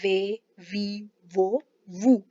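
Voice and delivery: वे वी वो वू said very slowly; slow drill of वे वी वो वू; syllable drill. Shown as a demonstration. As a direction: draw out each v syllable. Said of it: "ये बनेगी व".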